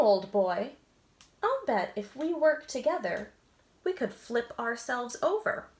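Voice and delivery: lively storybook read-aloud with voices; animated picture-book narration; reading to children. A narrator's reading. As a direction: read it like bedtime story time.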